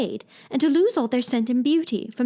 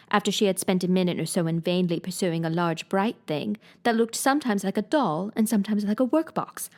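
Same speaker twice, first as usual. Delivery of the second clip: plain reading voice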